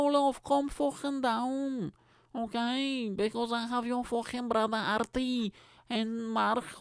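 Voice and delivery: put-on high-pitched scolding mother in a thick Scouse accent; slow, drawn-out Scouse nagging; Scouse mum. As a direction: tell off your daughter as a hammed-up Scouse mum. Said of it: "I don't usually do Scouse accent".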